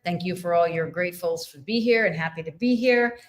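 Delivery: usual speaking voice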